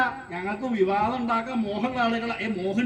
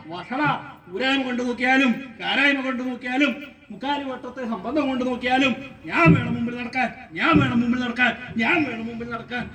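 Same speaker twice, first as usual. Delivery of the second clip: rapid, rhythmic volley of insults; squabbling man insisting on his precedence; quarrelling Brahmin; 4 semitones higher than usual. Acted. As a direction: rattle off the boast like a repeated refrain. Acts the quarrelling men.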